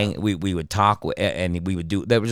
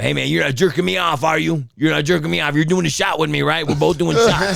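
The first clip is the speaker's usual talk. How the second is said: higher-pitched, mock-suspicious drinking buddy; retold pushy pal, voice raised in pitch; insistent pestering; 6 semitones higher than usual. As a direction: badger a friend to keep up. Friend pesters him by phone.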